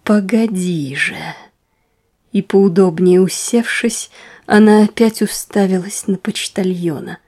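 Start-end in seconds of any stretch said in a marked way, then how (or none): 0.0-1.2 slow, vexed warning; hissed threat. Acted. Wife threatening her meddling husband, hissing slowly. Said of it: "прошипела дьячиха, поморщившись от досады".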